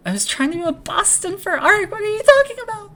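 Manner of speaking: very high, loud squeal; mock protest. Protesting about what the other is talking about, squealed mock outrage. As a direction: squeal it in exaggerated disbelief, speeding up.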